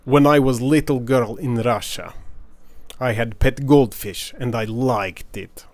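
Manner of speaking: put-on slow, heavy Russian accent. Quoting her friend for the viewers, slow Russian accent. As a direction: lean into the Russian accent, taking your time. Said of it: "do a Russian accent".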